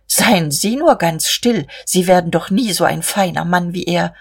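usual speaking voice

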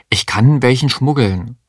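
ordinary delivery

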